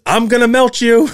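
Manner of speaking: high, loud mock-gleeful kid shout; a boy bursting in to tease his parents, high and loud; playful kid shout. Son bursts into bedroom playfully.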